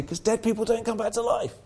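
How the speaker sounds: high-pitched mock sceptic; incredulous objection. Preacher voicing a doubter's objection to the resurrection, high incredulous mockery.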